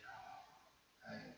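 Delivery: breathy near-whisper to yourself; hushed inner thought. Retold or imitated. Hearing the girl's story, thinking to himself, hushed whisper. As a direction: whisper the realisation inward. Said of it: "I'm thinking".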